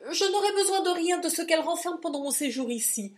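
high, loud, urgent protest; frantic, keep him off the trunk; frantic insistence. Acted. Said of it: "s'écria Silas".

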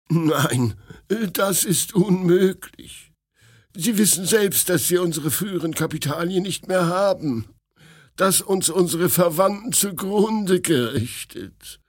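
high, lamenting protest; aggrieved refusal. A narrator's reading. Refusing money to the prince, high and aggrieved. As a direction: protest as if wronged.

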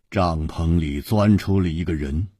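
usual speaking voice

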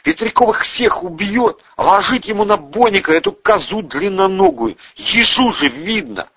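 high-pitched alarmed commentary; dismayed exclaiming. A narrator's reading. Bandura foresees the gunman killing, dismayed. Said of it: "ахнул Бандура".

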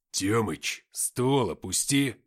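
loud, slow barked command; barked order. A narrator's reading. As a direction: bark it as an order. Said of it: "рявкнул Уфимцев".

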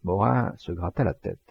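ordinary delivery, nothing marked

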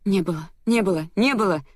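understated scream, voice rising on each repetition; crying out. A narrator's reading. Heroine cornered by the memory, crying out in denial. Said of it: "кричала я".

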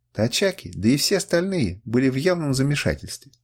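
usual speaking voice

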